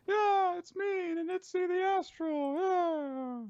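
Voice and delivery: very high, loud cartoon character voice; Ninitsi the Astral. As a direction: squeak out a showy self-introduction. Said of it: "and he's like".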